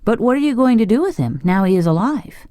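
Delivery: put-on high, quick boy's question; wondering boy. A narrator's reading. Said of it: "asked Tip, wondering".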